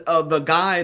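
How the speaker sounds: ordinary talk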